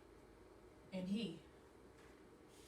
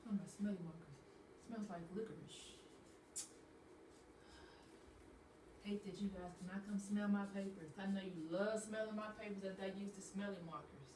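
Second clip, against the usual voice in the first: quiet aside; 2 semitones lower, 9 dB quieter than usual. Real, not acted. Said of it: "aside about smelly markers".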